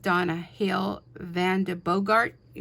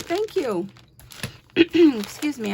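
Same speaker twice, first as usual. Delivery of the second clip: throat-clearing, frog in the throat; 5 semitones higher, 5 dB louder, slower than usual. Real, not acted. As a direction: clear the throat mid-phrase. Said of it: "I have a little frog in my throat".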